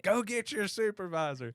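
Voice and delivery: high, loud mock demand, half laughing; retold hollering. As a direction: shout it up high like the idiots on police videos. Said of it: "I was hollering at that point".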